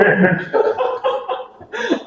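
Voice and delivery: real high-pitched burst of laughter; soaring laugh; big high laugh. Real, not acted. Laughs at the memory.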